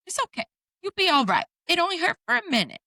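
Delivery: put-on high sweet child's voice; a little girl consoling her mother; toddler comforting. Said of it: "She goes".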